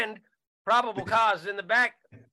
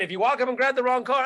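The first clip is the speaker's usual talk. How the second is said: usual speaking voice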